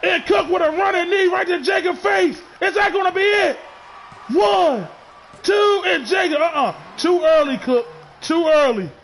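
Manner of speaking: shouted, high-pitched near-fall call; wrestling commentator. Acted. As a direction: yell the count like a ringside announcer. Near-fall called live, shouting.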